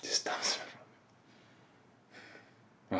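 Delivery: barely voiced, near whisper; hushed grief. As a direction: let it sink to a pained whisper. Grieving account of father's humiliation.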